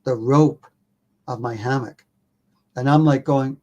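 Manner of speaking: normal talking voice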